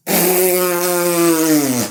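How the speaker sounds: loud high sniff; sniff, then recoil; high disgusted sniff. Acted. Client sniffing the fart in disgust.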